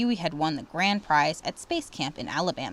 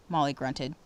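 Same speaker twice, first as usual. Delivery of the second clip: usual speaking voice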